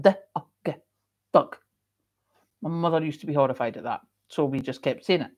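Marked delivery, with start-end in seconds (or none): 0.0-0.7 D-U-G as slow separate letter sounds, deh, uh, guh; mock phonics spelling of D-U-G; spelled by sound. Shown as a demonstration. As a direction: sound out D-U-G one letter at a time, very slowly.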